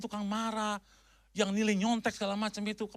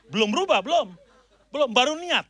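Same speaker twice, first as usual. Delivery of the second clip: louder, pressing belum again and again; emphatic belum; 7 dB louder than usual. Acted. Preacher presses the congregation, loud emphatic belum. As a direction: hammer the word belum home. Preacher insists not changed yet.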